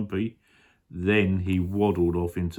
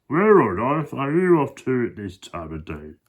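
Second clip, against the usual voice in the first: higher, louder hippo voice; Humpy Rumpy; 5 semitones higher, 5 dB louder than usual. Acted. Hippo questioning the crocodile, high and loud. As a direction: ask it in a swooping, nosy hippo voice. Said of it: "said Humpy Rumpy".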